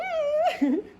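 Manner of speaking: high-pitched burst of laughter. Real, not acted. Hearing the boarder's greeting, high laugh.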